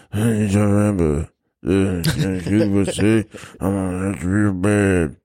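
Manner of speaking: slurred, mumbled Stallone growl; Sylvester Stallone impression. Joking to a co-host, mumbled Stallone impression. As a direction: mush the words like Rambo. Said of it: "My current Sylvester Stallone impression is".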